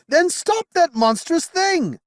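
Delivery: desperate cry. Acted. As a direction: beg it at full voice. Knight pleading desperately.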